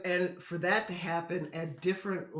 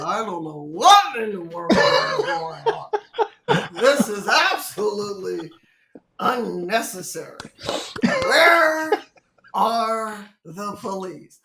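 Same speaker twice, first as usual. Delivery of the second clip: Marv Albert impression; 2 semitones higher, 8 dB louder than usual. Fans rocking the van, Marv Albert alarmed and loud. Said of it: "give a Marv Albert impersonation".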